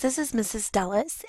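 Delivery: ordinary talk, nothing marked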